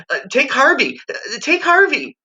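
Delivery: put-on high blubbering child's plea; sobbing plea. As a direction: sob it out as a desperate kid. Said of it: "he, he sobbed".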